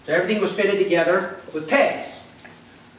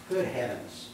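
ordinary talk

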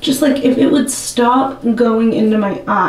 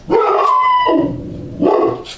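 sudden very high yelp; startled squeal. Real, not acted. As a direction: yelp in shock at the cut. Gasp at first cut.

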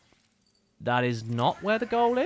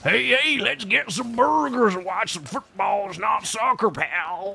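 higher, slow and drawn-out American voice; slow friendly American accent; 5 semitones higher, slower than usual. Shown as a demonstration. Streamer repeats the chat line, slower and more American. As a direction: lean into a laid-back American buddy. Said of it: "I do a very friendly American accent".

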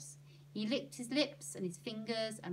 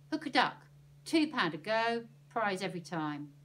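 loud barker's pitch; fairground barker; 7 dB louder than usual. Acted. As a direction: sell it like a stall barker. Stallholder shouts his pitch.

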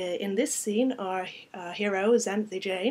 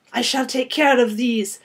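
put-on loud, raised French-accented declaration; rich French accent; 4 semitones higher, 13 dB louder than usual. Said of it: "with a rich French accent".

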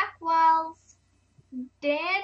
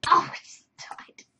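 whisper; 3 semitones higher, 6 dB louder than usual. Real, not acted. Whispered reaction to dying.

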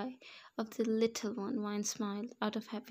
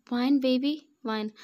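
alarmed call; 4 semitones higher, 9 dB louder than usual. A narrator's reading. Rushing to wife in labour.

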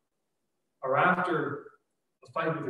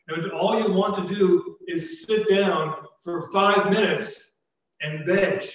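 usual speaking voice

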